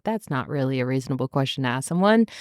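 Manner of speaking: low stern Adam. Retold or imitated. Voicing Adam defending her.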